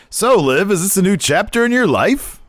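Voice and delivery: weird interviewer voice. Retold or imitated. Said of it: "All weird, like".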